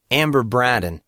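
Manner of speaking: ordinary delivery, nothing marked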